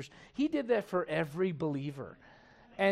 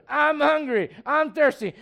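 put-on louder, higher whiny toddler shout; demanding child; 7 semitones higher, 7 dB louder, slower than usual. Nursery kid asked about mom, loud mock child demand. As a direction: shout it like a hungry little kid. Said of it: "ask those kids how their mom's doing".

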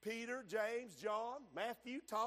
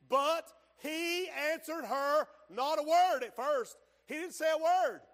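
"he answered her not a word" slow, higher and punched; stretched, raised preaching emphasis on each word; emphatic verse reading; 5 semitones higher, 6 dB louder than usual. Acted. Hammers the verse home.

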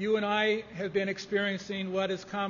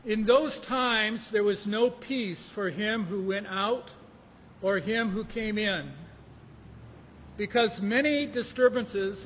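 slow, louder solemn reading of the verse; scripture reading; 5 dB louder, slower than usual. Acted. Pastor reading Chronicles to his congregation, slow and solemn. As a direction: read it out like holy writ to the church.

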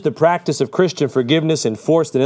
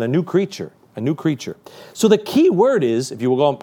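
ordinary delivery

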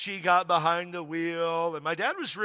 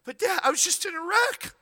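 high, squeaky mock whine, much higher; protesting daughter; 13 semitones higher than usual. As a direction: whine it like a panicked teenage girl. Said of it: "And Gloria going".

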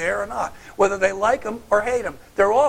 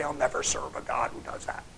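whispered mock vow; 6 semitones lower, 6 dB quieter than usual. The preacher whispers the sinner's vow against God, mocking. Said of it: "and say".

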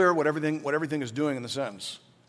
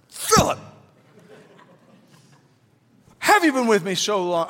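calls Philip's name much higher, then a louder disbelieving question; exasperated rebuke; 7 semitones higher, 6 dB louder than usual. Retold or imitated. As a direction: voice Jesus as gently exasperated, a little hammed-up. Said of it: "I imagine that was the tone".

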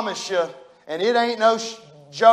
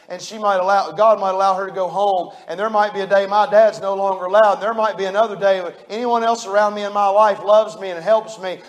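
ordinary talk, nothing marked

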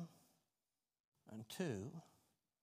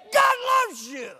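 preaching shout; 12 dB louder than usual. Real, not acted. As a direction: thunder it to the whole church. Preacher climaxes again.

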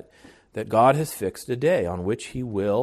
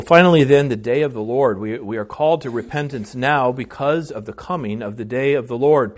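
ordinary talk

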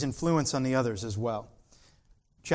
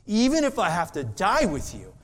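Peter's bold vow; 6 semitones higher than usual. Acted. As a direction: swear it like a proud disciple. Preacher voicing Peter's boast.

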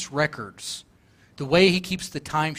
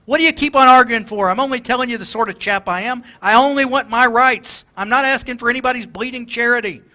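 higher, louder, stubborn retort; mock indignant shout; 5 semitones higher, 5 dB louder than usual. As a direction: bristle at the offer, loudly. Ghost argues back loudly.

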